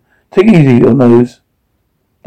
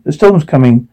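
usual speaking voice

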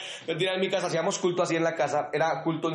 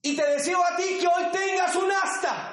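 shouted blessing; 12 semitones higher than usual. Acted. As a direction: proclaim the wish with full force. (bendición proclamada a gritos)

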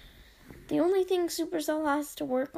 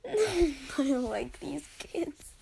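whispered aside; slower than usual. Real, not acted. Mock complaint after laughing.

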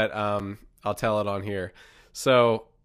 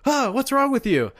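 higher startled exclamation; startled parents; 13 semitones higher, 4 dB louder than usual. Retold or imitated. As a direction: jump back from an icy touch, high. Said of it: "and they're like".

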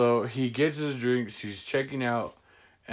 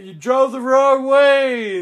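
high, loud, slow taunt; mocking call-out; 13 semitones higher, 9 dB louder, slower than usual. Teller taunting the rude customer, high and slow. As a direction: sing it out to mock the driver. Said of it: "I was like".

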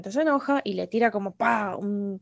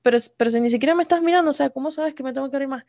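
hurried, slightly raised objection; Aang's rushed protest; 3 semitones higher, 3 dB louder, faster than usual. Retold or imitated. Retelling Aang's complaint to Jon Jon, rushed protest. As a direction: rattle off the complaint. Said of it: "que le dice muy rápido".

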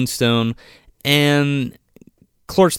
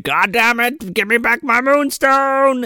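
much higher, slow screechy-sounding witch rant; cartoon witch rant; 10 semitones higher, slower than usual. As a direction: wail for the stolen stone like a cartoon witch. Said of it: "she's like".

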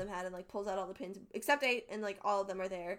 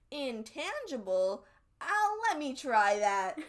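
slow, higher, louder mock curiosity; intrigued retort; 4 semitones higher, 7 dB louder, slower than usual. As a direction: draw it out as an intrigued character. Acts John's curious response.

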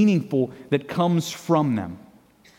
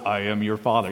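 low, soft menacing villain line; imitating a toddler's deep villain voice; intimidating voice; 9 semitones lower, 5 dB quieter than usual. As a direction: drop low and loom like a dark father figure. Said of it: "she would say in an intimidating voice".

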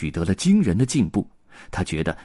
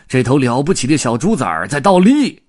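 loud, higher excited holler; shouting; 6 semitones higher, 8 dB louder than usual. A narrator's reading. Circus big man shows everyone the pig, shouting. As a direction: announce it to the whole camp. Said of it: "并喊道".

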